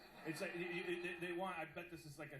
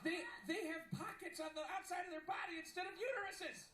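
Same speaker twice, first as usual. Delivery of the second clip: high, laughing outrage; appalled, voice pushed way up; mock horror; 12 semitones higher, 5 dB louder than usual. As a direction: rant in high-pitched comic alarm. Comic rant about horrifying kangaroos.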